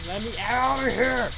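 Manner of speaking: high, loud shout for release; loud, high-pitched outburst; trapped yell. Real, not acted. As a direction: yell to be let out. Trapped in the game.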